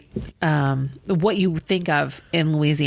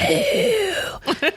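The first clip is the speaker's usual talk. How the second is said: much higher squeaky "Ew." of disgust; mock disgust. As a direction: recoil at the thought like it's gross. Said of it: "I was like".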